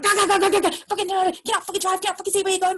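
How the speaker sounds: high, loud mock tirade; imitating furious woman. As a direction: rattle it off like the raging driver. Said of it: "And she was like".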